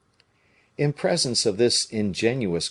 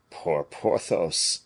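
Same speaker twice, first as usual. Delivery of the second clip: slow, tender pity; 3 semitones lower, much slower than usual. A narrator's reading. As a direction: sigh it with sorrow. Aramis pities.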